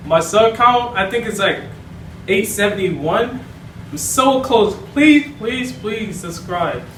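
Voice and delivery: usual speaking voice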